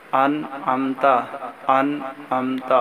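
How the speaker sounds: gentle slow drill of انعمت; careful pronunciation. Shown as a demonstration. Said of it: "اس کے الفاظ بھی ٹھیک ہوں".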